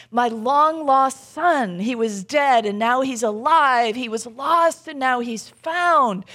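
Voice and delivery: loud, drawn-out fatherly rejoicing; joyful welcome. Retold or imitated. Father embracing his returned son, joyful proclamation.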